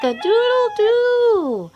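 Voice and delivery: loud high cock-a-doodle-doo crow; rooster crow. Acted. Reading to kids, crowing like the rooster.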